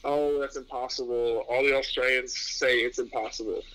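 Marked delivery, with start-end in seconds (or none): none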